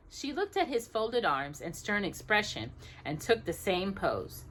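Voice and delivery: ordinary delivery, nothing marked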